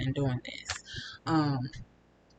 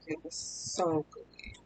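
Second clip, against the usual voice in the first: whispered; 6 dB quieter, slower than usual. Real, not acted. Recalls the taste.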